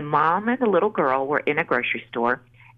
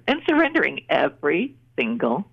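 normal talking voice

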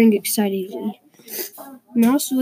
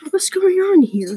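high-pitched storybook growl; indignant growl; 9 semitones higher, 3 dB louder than usual. A narrator's reading. Bear hears the splash, indignant growl. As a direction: demand it sharply, pitched well up. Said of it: "he growled".

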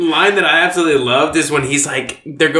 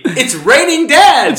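excited quote; 11 semitones higher, 6 dB louder, slower than usual. Retold or imitated. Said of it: "he's like".

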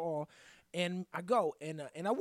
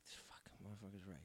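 very low, hushed grumble to himself; whispered aside; 7 semitones lower, 17 dB quieter than usual. Retold or imitated. Realising the other driver is right, whispered aside. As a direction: whisper it under your breath.

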